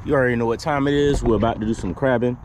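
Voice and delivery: usual speaking voice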